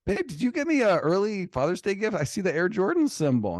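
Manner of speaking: loud, high excited gasp of joy; retold delighted surprise. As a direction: act out thinking you got a present. Acts out delight at supposed gift.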